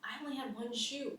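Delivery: rising, incredulous complaint; dismayed realization. Retold or imitated. Injured rider discovers a missing shoe, dismayed and high. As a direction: voice climbing high in mock dismay.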